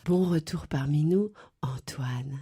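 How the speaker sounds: low, slow breathy murmur; sultry whisper. Pretend. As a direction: breathe it into his ear. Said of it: "susurrer à mon oreille". (voix susurrée sensuelle)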